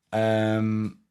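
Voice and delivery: ordinary talk, nothing marked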